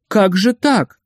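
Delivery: reproachful surprise. A narrator's reading. Administrator holding back a poet who tries to flee, reproachful. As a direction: chide a customer with pained astonishment. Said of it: "удивился он укоризненно".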